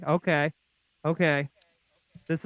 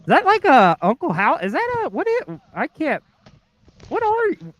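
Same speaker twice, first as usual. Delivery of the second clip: high, bewildered questions; baffled surprise; 10 semitones higher, 4 dB louder than usual. Real, not acted. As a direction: squeak out total confusion. Shocked at a strange mask.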